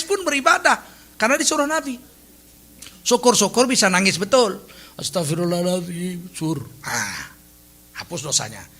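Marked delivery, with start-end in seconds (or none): 5.0-8.7 softer, put-on weepy plea; pretend crying. Shown as a demonstration. Preacher shows how to cry while asking forgiveness, pretend crying. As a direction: fake a tearful prayer for forgiveness. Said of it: "Nggak bisa, pura-pura nangis".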